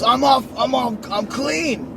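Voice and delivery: high, loud mock-proud announcement; retold crackhead friend. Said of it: "he's just a fucking crackhead".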